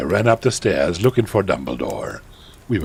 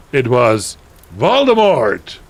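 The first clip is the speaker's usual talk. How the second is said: ordinary delivery, nothing marked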